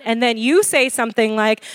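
ordinary delivery

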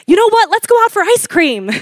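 brightly distract an upset child, hammed up; over-eager parent voice; mock cheery parent.

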